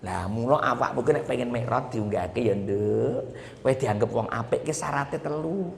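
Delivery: usual speaking voice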